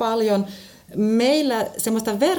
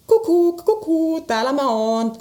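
high sing-song "kukuk, kukuu"; lilting peekaboo, pitched up; playful peekaboo call; 6 semitones higher than usual. Shown as a demonstration. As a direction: call it like hide-and-seek with a child. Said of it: "voidaan myös sanoa kukuk".

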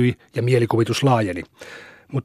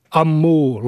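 playful mock bull bellow, a bit louder; boy mooing like a bull; 5 semitones higher, 4 dB louder than usual. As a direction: moo like a bull to show how it roars. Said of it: "lopetti Esa näytöksensä".

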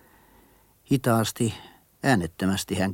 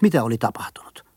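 ordinary talk

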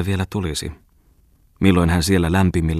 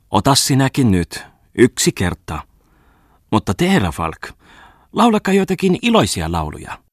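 merchant's wife; 7 semitones higher, 5 dB louder than usual. A narrator's reading.

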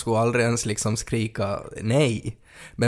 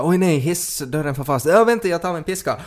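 high-pitched pretend panic; hammed-up cry to hold on; mock alarm; 7 semitones higher, 4 dB louder than usual. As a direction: act out a sudden emergency, voice jumping up. Acted lift door emergency.

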